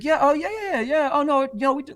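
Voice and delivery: very high, fake-confident yeahs; mocking a rep faking it, high and loud; flustered kid. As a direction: bluff like a caught-out rep. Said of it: "He's like".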